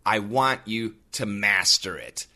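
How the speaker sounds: ordinary talk, nothing marked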